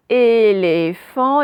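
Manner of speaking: "éléphant" loud and very slow, first é held long; syllable demo. Shown as a demonstration. Teacher showing pupils the first syllable, loud stretched demo. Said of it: "la première syllabe".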